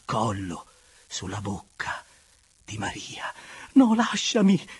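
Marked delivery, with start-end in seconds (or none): 3.7-4.7 high, loud, breathy protest; sudden loud, high plea to be released; struggling cry. Acted. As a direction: cry out while breaking free of an embrace. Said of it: "ella gridò liberandosi dalla stretta con uno sforzo".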